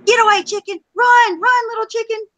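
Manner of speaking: high, loud laughing cheer; cheering the chicken. Real, not acted. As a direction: shout encouragement to the fleeing chicken.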